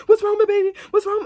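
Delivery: high, loud imitated wail; loud mock-distraught cry; crying daughter. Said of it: "My daughter started crying".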